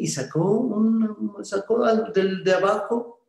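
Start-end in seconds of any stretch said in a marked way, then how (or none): none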